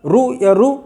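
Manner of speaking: रू said with a long u; रू sound. Shown as a demonstration. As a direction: voice the रू as the regional reading. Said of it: "की आवाज़ निकालते हैं". (रू की ध्वनि निकालें)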